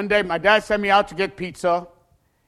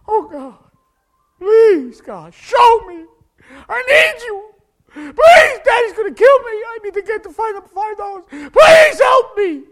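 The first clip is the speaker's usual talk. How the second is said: high, loud, pleading wail, drawn out slow; desperate boy sobbing a prayer on the stairs; crying prayer; 13 dB louder, slower than usual. Retold or imitated. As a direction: beg God through tears. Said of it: "I started crying".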